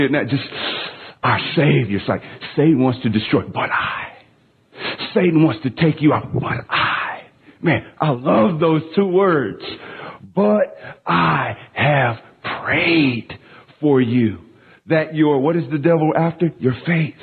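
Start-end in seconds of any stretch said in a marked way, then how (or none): 3.4-4.1 hushed breathy but I; awed whisper of Jesus's words. Retold or imitated. 6.3-7.2 hushed breathy but I; awed whisper of Jesus's words. Retold or imitated. 10.3-14.4 tender. Retold or imitated. Quoting Jesus tenderly, slowed.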